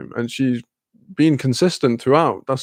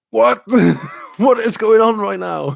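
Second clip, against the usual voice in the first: loud, much higher, laughing disbelief; shocked outburst; 8 semitones higher, 4 dB louder than usual. Retold or imitated. Imagined viewing buddy reacting to Tickled, loud laughing shock. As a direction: yell it like the plot just blindsided you. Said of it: "you need someone to go like".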